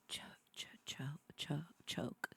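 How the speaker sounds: breathy unvoiced whispered choke. Vlogger jokingly whispering about her crush, softly. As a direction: whisper it like a dark little joke.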